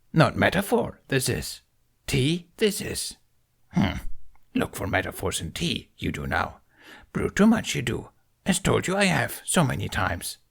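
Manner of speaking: put-on a wise old creature, gently scolding; Yoda voice. Yoda lecturing Ben on his tea, amused. Yoda's voice in story.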